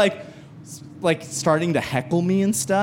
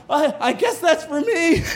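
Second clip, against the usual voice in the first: sheepish laugh; 10 semitones higher than usual. Retold or imitated. Comic realizes he is done.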